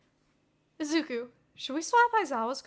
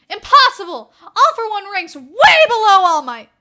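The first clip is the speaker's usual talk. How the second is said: loud, high passionate protest; indignant fanboy outburst; 8 semitones higher, 19 dB louder than usual. Acted. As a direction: defend your hero hotly.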